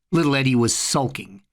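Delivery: ordinary delivery, nothing marked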